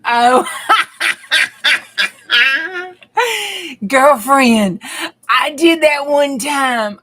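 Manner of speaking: loud, laughing burst at a comment; real laughter, loud and slow; laughing loudly. Real, not acted. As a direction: crack up and talk through it.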